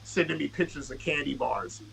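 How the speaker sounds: ordinary delivery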